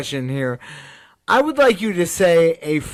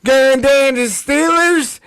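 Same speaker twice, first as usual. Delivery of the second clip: higher, slow mock Pittsburgh Yinzer drawl; a hint of a Pittsburgh Yinzer accent, pitched up and slow; 8 semitones higher, 4 dB louder, slower than usual. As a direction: toss off a quick Yinzer impression. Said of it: "in your best Yinzer accent".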